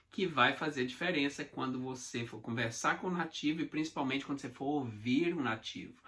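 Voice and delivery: usual speaking voice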